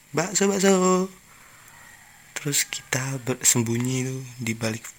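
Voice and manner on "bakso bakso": high, slow, drawn-out hawker cry; street vendor's call. Retold or imitated. Meatball seller passing houses, mimicked sing-out call. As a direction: hawk meatballs down the street, louder. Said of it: "saat ada tukang dagangan lewat".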